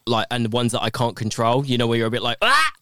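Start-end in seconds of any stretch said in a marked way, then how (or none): 2.4-2.7 sudden high strangled yelp; a short choked cry, high and loud. Shown as a demonstration. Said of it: "That sounds like I'm being strangled".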